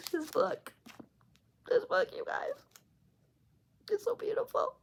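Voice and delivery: real tearful squeak, high and soft; tearing up. Real, not acted. Reader overcome by the proposal she just read, tearing up. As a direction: voice thins to a high, choked whisper. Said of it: "I'm tearing up again just reading that part".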